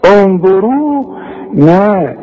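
انظرونا with the waw and alif held two counts; natural madd. Shown as a demonstration. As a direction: stretch the u and a in انظرونا. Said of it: "النون مفتوحة". (مد الواو والألف في انظرونا)

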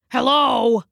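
lightly called greeting; calling out. Acted. Dog calling for the cat in the alley, lightly calling. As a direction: call out on arrival, not too loud. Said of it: "he called when he arrived".

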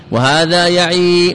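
يعي ending in a held long ī; final ي kept. Shown as a demonstration. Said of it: "بالإثبات".

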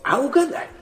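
high, playful taunt; teasing challenge. Acted. Father teasing his son about the groom, high and playful. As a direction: tease him to guess, lilting high. Versilov teases with a guess.

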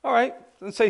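lightly acted guard, quick and high; guard's test. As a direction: put the suspect to the test. Guard tests the escapee.

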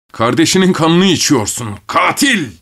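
high-pitched outcry; angry accusation. A narrator's reading. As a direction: hurl the accusation, rising on the last word. Enraged man accuses his uncle.

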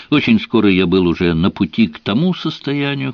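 ordinary delivery

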